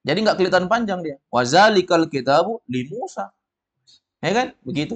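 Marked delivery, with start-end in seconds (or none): 1.3-3.3 "Wazalikal kitabu li Musa" slow, falling at the end; statement intonation. Shown as a demonstration. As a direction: close "li Musa" like a full stop. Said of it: "Jadi intonasi bikin orang paham". ("li Musa" turun, bukan bertanya)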